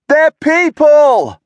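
high, slow, loud shout; alarmed shout across the room. A narrator's reading. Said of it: "Alex shouted".

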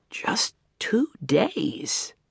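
breathy slow whisper to himself; barely voiced, drawn out. A narrator's reading. Boy murmuring to himself by the river, whispered.

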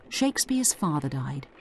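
normal talking voice

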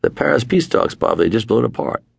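a hint of a busy diplomat; mock Kissinger. Kissinger returning the producer's urgent call, lightly voiced.

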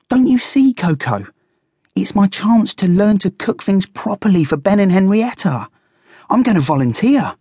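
high, eager squeaky character; keen, high-pitched little animal; earnest excited guinea pig. A narrator's reading. Fuzzy pleads his case.

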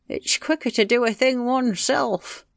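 grumbling. Acted. Husband fed up with his deaf wife, grumbling. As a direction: grumble like a put-upon husband. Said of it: "he grumbled".

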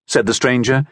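ordinary delivery

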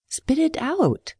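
high, slow, urgent plea; alarmed young woman. Acted. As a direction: tell him to spit it out, worried. Urging him to spit out strawberry.

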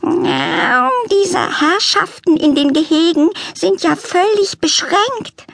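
high, prim, put-upon lament; complaining cat lady. A narrator's reading. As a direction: sniff at the disappointing zoo.

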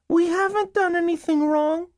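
put-on very high little-girl voice; young girl. Girl pleading with caseworkers at the door, young child voice. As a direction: pitch it way up like a small child.

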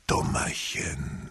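whisper. Acted. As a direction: breathe it teasingly into her ear. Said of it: "flüsterte ihr Viktor ins Ohr".

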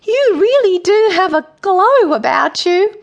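high, loud, over-sincere flattery; forced joviality. A narrator's reading. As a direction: try too hard to sound jovial. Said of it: "trying very hard to sound jovial and sincere".

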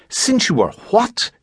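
put-on a girl hearing something unbelievable, astonished. A narrator's reading. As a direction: shoot up high in shock. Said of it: "wrinkles of astonishment".

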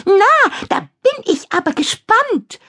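high, sneering witch voice; mocking. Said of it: "höhnt Kadabra".

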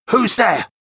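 high, sharp challenge into the dark; startled cry. A narrator's reading. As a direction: call out in mild alarm. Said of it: "cried Daniel".

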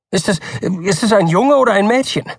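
high, stammering question; anxious father. A narrator's reading. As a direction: stumble over it nervously. Anxious father presses doctor.

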